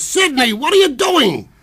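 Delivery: high, loud startled shout at the actor; director yelling. Retold or imitated. Director shouting at Sidney on set, loud and high. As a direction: bark it at him across the set. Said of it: "And the director says".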